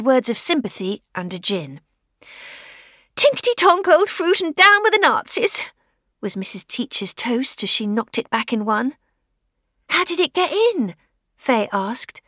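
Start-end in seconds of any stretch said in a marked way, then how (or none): 3.2-5.6 put-on much higher, louder old-woman voice; a rousing tipsy toast in an old biddy voice; old lady toast. Widow toasting with gin, high plucky old-lady voice. 9.9-11.0 put-on girl's voice. Young Fay asks.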